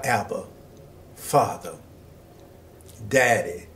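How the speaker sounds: soft, low and tender, almost hushed; intimate Daddy. Acted. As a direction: call Father the way a small child says Daddy. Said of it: "That's a very intimate term".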